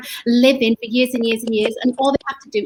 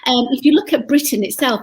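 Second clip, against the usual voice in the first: ordinary delivery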